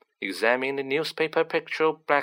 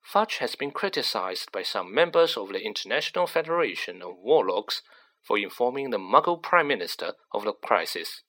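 ordinary delivery

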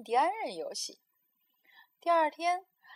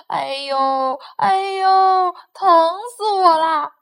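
pain wail; 6 semitones higher, 16 dB louder, slower than usual. Acted. Faking toothache, wailing.

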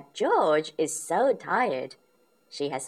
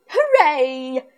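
Peppa cheering; 10 semitones higher, 12 dB louder than usual. A narrator's reading. Peppa finds her coin.

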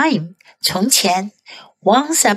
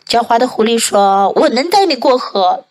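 usual speaking voice

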